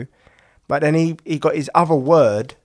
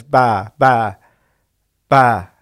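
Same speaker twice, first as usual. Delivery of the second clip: put-on baby bleating ba, getting louder; toddler sheep noise; 2 semitones lower, 5 dB louder, much slower than usual. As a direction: copy the baby doing a sheep. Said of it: "he goes".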